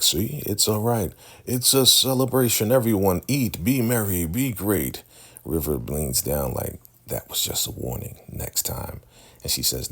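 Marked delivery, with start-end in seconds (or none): 0.0-5.0 mock jolly host. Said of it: "he's like". 7.1-9.0 whispered, breathy mock threat; retold whispered warning. River leans down to warn Ruth, whispered menace.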